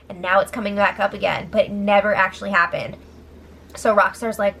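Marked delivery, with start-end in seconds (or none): none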